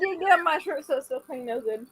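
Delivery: talking through a mouthful of ice cream; words round a bite of ice cream; mouth full. Real, not acted. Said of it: "your mouth is full of ice cream".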